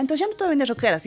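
snelle spottende quote. Retold or imitated. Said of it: "Algunas me decían".

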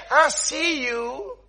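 soft, slow, drawn-out pronouncement; mock prophet. Prophet claiming a vision of harm, slow mock solemnity. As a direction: stretch it out like an ominous vision. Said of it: "come to me and say".

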